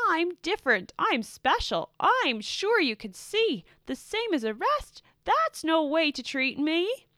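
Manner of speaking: high, loud, indignant rant; ranting and fussing. A narrator's reading. Blue rants at the confused purples, loud and indignant. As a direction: demand special treatment at full volume. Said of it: "as he ranted and fussed".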